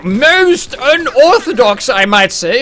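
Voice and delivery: loud, high, drawn-out mock pomposity; hammed-up grand pronouncement; mock-formal exclamation. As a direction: declaim it slowly, high and loud. Hammed-up surprise at enemy classes.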